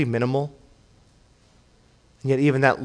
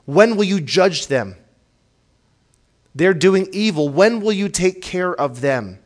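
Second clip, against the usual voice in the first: pleading cry; 5 semitones higher, 3 dB louder than usual. Acted. Voicing psalmist's imprecation.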